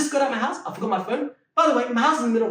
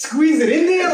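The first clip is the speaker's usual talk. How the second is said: higher, louder, incredulous complaint; exasperated protest; 7 semitones higher, 5 dB louder, faster than usual. Retold or imitated. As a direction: plead in loud disbelief at the absurd task. Acting out exasperation at tiny hole.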